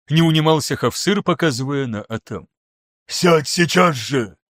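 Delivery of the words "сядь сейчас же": slow growled order; growling brute. A narrator's reading. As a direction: growl it out like a wolf. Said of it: "зарычал Багудар". (медленное рычание зверя)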